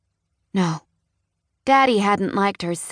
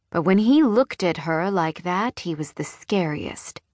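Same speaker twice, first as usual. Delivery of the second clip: ordinary delivery, nothing marked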